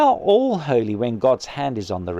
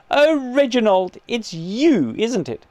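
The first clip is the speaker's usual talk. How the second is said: disappointed; 7 semitones higher, slower than usual. A narrator's reading. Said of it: "in a tone of unconcealed disappointment".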